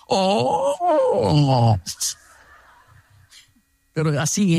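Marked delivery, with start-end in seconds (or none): 0.1-1.8 wary, rising and falling "Oh"; that suspicious tone. Retold or imitated. Enemy hearing the lunch invitation, wary sliding "Oh". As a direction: let the "Oh" slide up and down. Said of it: "en ese tono".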